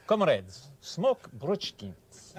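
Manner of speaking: put-on slow, high Russian-accented sales slogan. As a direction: proclaim it like a Russian cigarette commercial. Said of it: "Even in Russia, I did one once".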